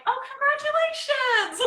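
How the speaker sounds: high, loud bright surprise at the news; cheery congratulations. Retold or imitated. Mom hearing a friend come out, bright loud cheer. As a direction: beam it out like it's great news.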